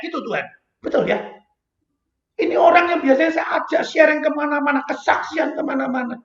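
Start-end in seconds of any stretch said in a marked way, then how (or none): none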